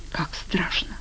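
frightened, barely audible whisper; very soft, breathy hush. A narrator's reading. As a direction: whisper it in dread. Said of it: "прошептала Ира".

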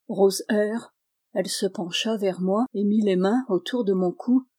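plain reading voice

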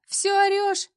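high, loud jeer; mocking yell. A narrator's reading. Woman jeering at the yelling colleague, high and loud. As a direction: crow it loudly at her.

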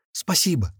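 put-on high squeak of a small boy; squeaky little boy. A narrator's reading. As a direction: squeak the thanks like a child. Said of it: "пропищал мальчик". (писклявый голос мальчика)

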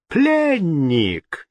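cheerful echo; gleeful. A narrator's reading. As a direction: gloat over the news. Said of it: "весело отозвался Генрих".

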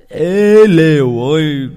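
very loud, drawn-out call. Retold or imitated. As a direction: holler it slowly across the house. Gọi chó trong nhà. (gọi to kéo dài)

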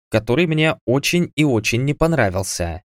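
ordinary talk, nothing marked

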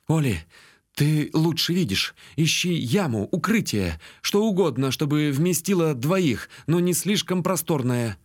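urgent instructions to a companion; urgent orders. A narrator's reading. Urgent orders while under attack.

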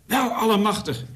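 high-pitched cry of wonder; moved exclamation. A narrator's reading. Loftus has heard Anna's letter read out, moved exclamation. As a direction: exclaim it, touched and amazed. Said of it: "riep Loftus ontroerd".

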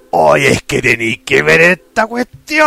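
put-on leering old man, shouted and drawn out; hammy lecherous old geezer pitch; dirty old man voice. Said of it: "Ay, la voz de viejo verde".

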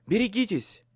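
loud, high urgent warning; warning shout. A narrator's reading.

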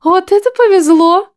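high, delighted exclamation; thrilled at her luck; enthusiastic. A narrator's reading. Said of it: "глаза загорелись энтузиазмом".

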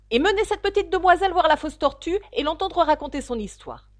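a lightly voiced queen giving orders.

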